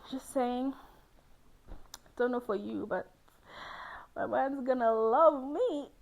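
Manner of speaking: ordinary talk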